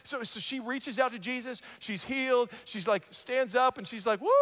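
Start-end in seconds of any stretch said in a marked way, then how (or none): 4.2-4.3 high imitated whoop of relief; quick high-pitched retold cheer; joyful whoop. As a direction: whoop like someone just healed. Healed woman stands up cheering.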